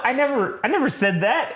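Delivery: high, defensive denial; high protest. Retold or imitated. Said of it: "He's like".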